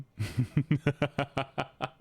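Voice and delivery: long staccato chuckle; drawn-out run of little laughs; chuckling. Real, not acted. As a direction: laugh in a string of short bursts. Laughs at chat's taste.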